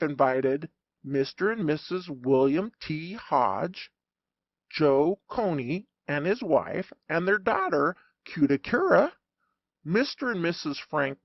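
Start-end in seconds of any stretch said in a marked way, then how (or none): none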